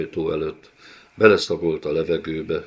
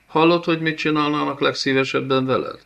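higher, fast, strange put-on voice; gleeful taunt; 8 semitones higher, 3 dB louder, faster than usual. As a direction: rattle it off with cruel glee. Villain taunts, soldiers jeering. (kárörvendő, gyors gúny)

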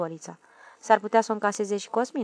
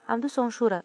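ordinary delivery, nothing marked

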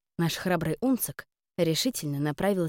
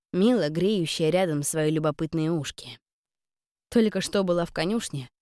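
plain reading voice

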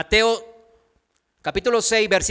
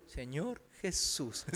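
Señor Jesús echoed much softer and lower, slow; a reluctant teenager mumbling it back; mock half-hearted mumble; mumbled repeat; 5 semitones lower, 14 dB quieter than usual. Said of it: "y ellos".

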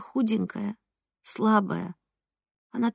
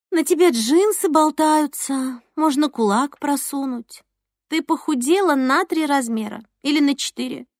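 envious friend; 6 semitones higher than usual. Acted. Alyona's envious remark quoted.

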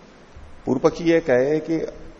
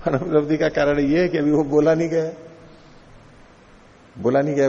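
opponent's voice; 3 semitones higher than usual. Acted. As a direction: play the opponent pleased with his clever answer. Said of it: "पूर्व पक्षी देखो इस ढंग से बोल रहा है".

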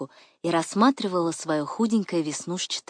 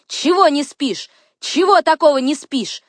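loud, high, insistent questions; manic, raised-voice taunting; excited badgering; 8 semitones higher, 8 dB louder than usual. A narrator's reading. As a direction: tease relentlessly, pushing each question. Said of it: "хохотала и приговаривала".

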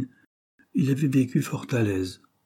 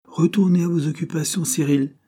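higher, firm dismissal of a clerk; dignified dismissal; 8 semitones higher, 4 dB louder than usual. A narrator's reading. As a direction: wave him back to work with cool authority. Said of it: "le repoussa de la main avec dignité".

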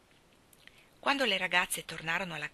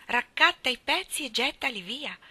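higher, louder indignant echo of the order; indignant repeat; 5 semitones higher, 4 dB louder than usual. Retold or imitated. Maid telling Anne how hurtful it was, indignant. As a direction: repeat it with outraged disbelief. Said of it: "Solo questo, signorina Shirley".

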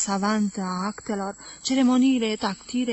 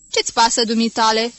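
louder, cheeky brush-off; bold retort; 7 dB louder than usual. Acted. Wives telling Jim to mind his business, loud and cheeky. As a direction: wave the husband off boldly. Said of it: "răspundeau îndrăzneț femeile".

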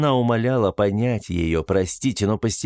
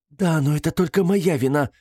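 higher-pitched, fervent admission; heartfelt exclamation; 7 semitones higher than usual. A narrator's reading. Detained woman confesses to the investigator, fervently. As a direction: exclaim it like a distressed woman owning up. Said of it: "горячо воскликнула женщина и заплакала".

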